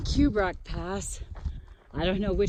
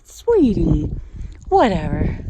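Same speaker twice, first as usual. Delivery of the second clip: a high sugary Aw then a dismissive whatever; mock cooing brush-off; 7 semitones higher, 4 dB louder, slower than usual. Hiker brushing off the angry pika, mock-sweet dismissive coo. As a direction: coo at it like a cranky toddler, then shrug. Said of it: "but I'm like".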